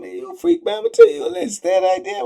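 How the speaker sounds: inner sneer. Retold or imitated. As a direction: scoff at him in your head. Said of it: "I'm like, in my mind".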